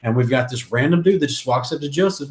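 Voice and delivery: usual speaking voice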